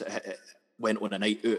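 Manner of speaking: ordinary talk, nothing marked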